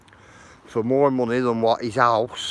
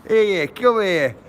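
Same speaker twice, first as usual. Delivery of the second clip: calling out; 6 semitones higher, 5 dB louder than usual. Pretend. Calling to a pet, joking.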